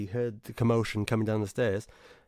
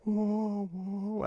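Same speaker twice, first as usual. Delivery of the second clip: higher sung "wah, wah" fading away; imitated chord echo; 10 semitones higher, 4 dB quieter than usual. Recalling the big piano chord at Abbey Road, higher fading "wah". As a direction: mimic the piano chord ringing out, higher then dying. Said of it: "you can hear the wah".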